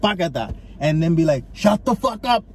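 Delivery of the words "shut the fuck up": raised-pitch movie-style snap; sudden hammed-up outburst; mock angry outburst. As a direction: act out telling off a noisy stranger. Imagined movie outburst at loud diner.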